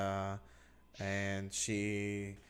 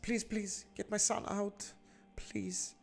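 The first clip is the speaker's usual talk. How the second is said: higher, begging voice; pleading mother; 11 semitones higher than usual. Acted. Mother begs the adventurer to free her son, high and pleading. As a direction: beg the stranger to save her boy. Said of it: "and she says".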